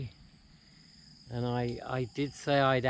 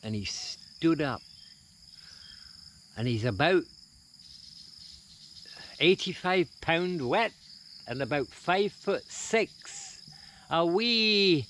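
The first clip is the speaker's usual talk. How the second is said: put-on Billy Connolly style Scottish accent, higher and lively; 6 semitones higher than usual. Vlogger telling a Billy Connolly joke, in a Scottish accent. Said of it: "I'm gonna be doing it in a Scottish accent".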